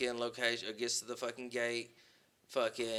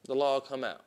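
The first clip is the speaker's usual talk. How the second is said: plain reading voice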